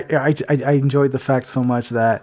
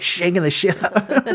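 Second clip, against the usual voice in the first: ordinary talk, nothing marked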